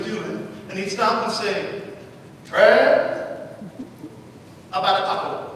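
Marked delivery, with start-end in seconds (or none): 2.4-5.4 put-on old Alabama man calling down the hall; Southern accent. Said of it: "He had this thick Southern accent".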